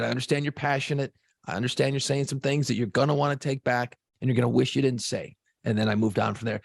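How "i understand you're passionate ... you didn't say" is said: fast, even, level-headed reasoning; quick calm reasoning. Retold or imitated. Host to angry coworker on phone, fast calm reasoning. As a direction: rattle off the calm explanation quickly. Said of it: "I said".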